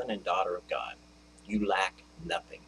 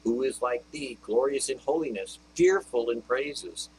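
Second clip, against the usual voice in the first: rising, loud preaching of praise; exalting the Lord before the congregation; fervent scripture proclamation; 3 semitones higher, 4 dB louder than usual. Acted.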